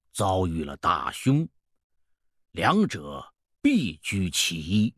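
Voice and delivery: put-on low, deliberate old sage; old fortune-teller, pronouncing. Old peddler diagnosing the ancestral curse, portentous. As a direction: weigh each phrase, drawing out the last.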